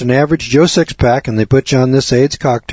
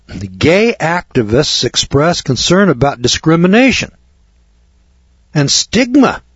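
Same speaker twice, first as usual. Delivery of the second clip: "stigma" leaps far higher in mock disbelief; sarcastic; 6 semitones higher than usual. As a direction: sneer the word "stigma" up high. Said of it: "Oh my God".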